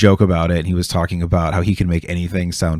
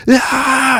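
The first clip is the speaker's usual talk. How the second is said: a sudden high trailer scream; high action yell; 4 dB louder than usual. Retold or imitated. Imitating the climax of a comedian's trailer, high yell. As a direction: blast out an action movie yell. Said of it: "it's all super action packed".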